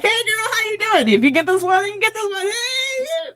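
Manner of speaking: high-pitched imitation of chummy women greeting; gushing audition greeting. Actresses greeting each other at an audition, high gushing voices.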